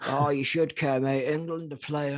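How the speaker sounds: a hint of a pushy England supporter; mock England fan. England fan telling the staff member to care, lightly mock lairy.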